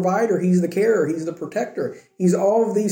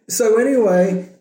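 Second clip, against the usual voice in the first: laughing; 5 semitones higher than usual. Real, not acted. Still laughing after joke.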